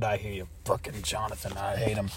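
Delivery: low, quiet grumble; bitter aside, sunk low and soft; resentful muttering. Acted. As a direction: mutter the grudge under your breath. Secret grumbling about coworker just greeted.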